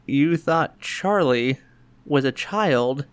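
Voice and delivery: slow, drawn-out disbelief; slowly laying out the absurdity; incredulous slow-down. Retold or imitated. Father stunned at son's belief.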